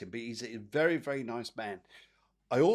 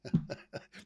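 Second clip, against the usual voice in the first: breathy giggle; 3 semitones higher than usual. Real, not acted. Laughs at own joke.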